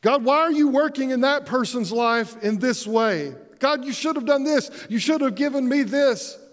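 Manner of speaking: high-pitched whiny complaint to God; complaining to God. Acted. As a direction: whine at God like a spoiled child. Voicing complaining believers.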